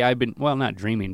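ordinary delivery

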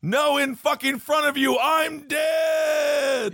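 very high, drawn-out comic cry; mock dead man's yell. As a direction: build to a long wail on the last word. Acting dead man's climactic call.

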